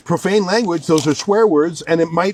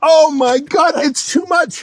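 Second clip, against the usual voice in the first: overwhelmed by the absurdity, shouting through laughter; laughing shout; 9 semitones higher, slower than usual. Real, not acted. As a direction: burst out in disbelieving laughter. Reacting to absurd Cockney definition.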